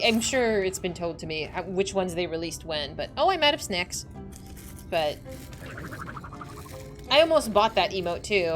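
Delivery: ordinary talk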